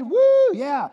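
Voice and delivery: high, loud cheer; excited whoop. Real, not acted. Preacher celebrating those who know God, excited whoop. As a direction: whoop with joy. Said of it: "I'm excited".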